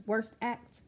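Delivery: ordinary delivery, nothing marked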